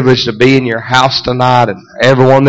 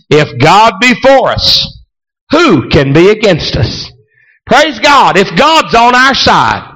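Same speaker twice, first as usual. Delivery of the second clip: preaching; 5 semitones higher, slower than usual. Acted. Preaching rising.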